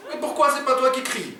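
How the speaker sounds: very high-pitched objecting robber; protesting sidekick. Acted. Oumar objects to his partner's plan, high-pitched protest. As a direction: whine back in a high character voice.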